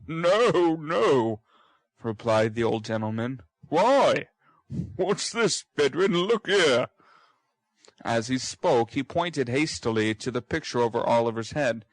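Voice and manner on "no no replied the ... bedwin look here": higher, louder exclamation; sudden alarm at the likeness; startled old gentleman. Acted. Brownlow cries out seeing the boy's likeness to the picture.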